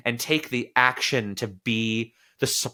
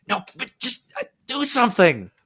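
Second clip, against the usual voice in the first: pleading inner voice; 12 semitones higher than usual. Retold or imitated. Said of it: "it's like".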